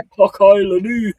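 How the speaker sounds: high, loud mock Scottish catchphrase. Streamer repeating his joke for the chat, hammed-up Scottish. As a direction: put on a Scottish accent through a laugh. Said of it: "Is that a Scottish accent?".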